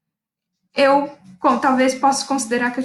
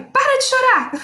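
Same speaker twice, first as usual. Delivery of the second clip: retold order, much higher and a bit louder; bossy order; 12 semitones higher, 4 dB louder than usual. To her crying mother during labour, a sharp commanding order. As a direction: snap the order like a boss in labour. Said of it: "eu fiquei muito autoritária".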